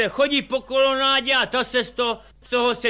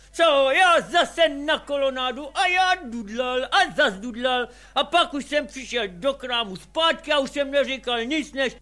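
normal talking voice

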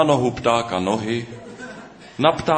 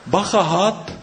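much higher, louder sudden warning; alarmed; 10 semitones higher, 4 dB louder than usual. Acted. Bird Noha spotting the snake, alarmed high cry. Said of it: "řekl Pták Noha".

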